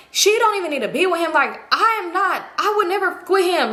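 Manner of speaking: high, emphatic imitated protest; defensive insisting. Acted friend defending herself.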